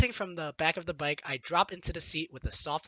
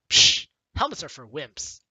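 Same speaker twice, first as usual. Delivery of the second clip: scoffing; 4 semitones lower, 7 dB louder than usual. Pretend. Scoffing rebel choice.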